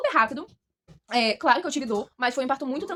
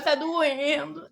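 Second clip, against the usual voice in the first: slow, drawn-out mock whine; retold complaint of pain; 2 semitones higher, much slower than usual. Mother re-enacting her labour complaint, slow imitated whine. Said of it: "Eu ficava".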